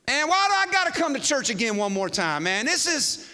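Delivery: high-pitched mock complaint; whining youngster. Youngster griping about coming to church, mock whine. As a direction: whine it like a put-upon kid.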